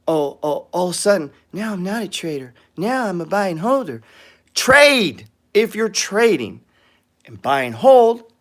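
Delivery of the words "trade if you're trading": slow, each word hammered, trade stressed; emphatic. Shown as a demonstration. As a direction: lay down the rule slowly. Hammering home the rule.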